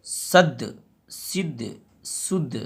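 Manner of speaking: final consonant bounced with a small echo; qalqala echo. Shown as a demonstration. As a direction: let the last letter knock and rebound. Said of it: "आखिरी में आवाज़ निकल रहा है".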